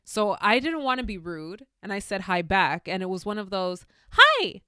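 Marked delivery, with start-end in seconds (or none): 4.1-4.6 squeaky, much higher and louder hi; a forced, bright-pitched greeting to someone she dislikes; fake high hi. Greeting someone she doesn't like with a fake, much higher hi. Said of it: "very high pitch".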